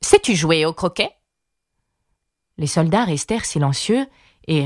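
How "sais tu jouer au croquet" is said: queen's brusque, raised voice; bawled question. A narrator's reading. Queen questions Alice: mild bawl. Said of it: "brailla la reine".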